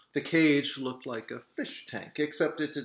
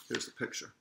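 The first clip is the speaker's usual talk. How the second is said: hushed aside; whispering; 7 dB quieter, faster than usual. Real, not acted. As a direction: say it under your breath. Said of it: "aside to listeners, unvoiced".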